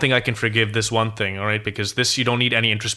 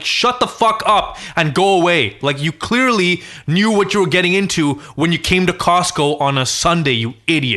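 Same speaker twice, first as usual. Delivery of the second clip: retold yell; 5 semitones higher, 4 dB louder than usual. Telling honking man off.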